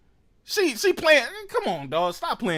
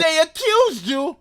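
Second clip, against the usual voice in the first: incredulous; 6 semitones higher, slower than usual. Real, not acted. Incredulous repeat of clip.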